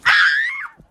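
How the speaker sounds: very high, loud scream; fangirl shriek. Real, not acted. Reader undone by the romantic line, fangirl shriek. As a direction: scream sky-high, overcome.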